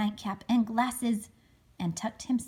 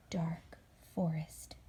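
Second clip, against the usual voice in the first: hushed whisper; 4 semitones lower, 7 dB quieter, slower than usual. A narrator's reading. Parent reading to child, whispering the scary forest. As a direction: whisper it, slow and spooky.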